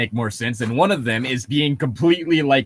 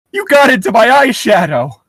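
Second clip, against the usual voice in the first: mock mother; 9 semitones higher, 6 dB louder than usual. Mother's joke line.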